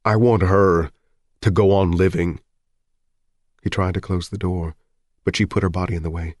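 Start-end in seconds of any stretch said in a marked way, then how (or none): 0.0-2.4 loud, broken through tears; tearful. Acted. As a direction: force it out through sobs. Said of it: "hardly coherent through her tears".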